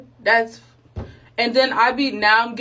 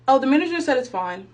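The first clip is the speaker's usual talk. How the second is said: ordinary talk, nothing marked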